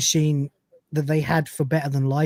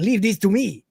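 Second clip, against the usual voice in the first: higher, put-on Mexican-Spanish accent; 4 semitones higher than usual. The cat tells his friends he'll handle it, Mexican accent. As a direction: imitate a bandito's Mexican accent. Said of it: "Mexican accent kind of".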